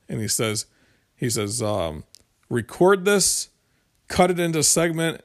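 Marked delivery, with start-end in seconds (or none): 2.5-5.2 lightly imitated politician's soundbite, higher and louder; Bernie Sanders. Host quoting the senator's pledge, a light imitation. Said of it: "That's my worst Bernie Sanders acc".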